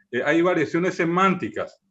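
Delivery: ordinary delivery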